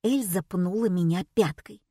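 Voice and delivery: usual speaking voice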